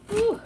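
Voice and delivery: sharp high exhale of relief; high whew as the onion fumes sting. Real, not acted. Onion fumes make eyes water.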